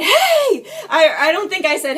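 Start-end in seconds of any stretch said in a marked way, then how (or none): 0.0-0.7 high, loud calling out; re-enacted yell. Retelling her call out the ripped-open window, loud high yell. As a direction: yell it to get someone's attention.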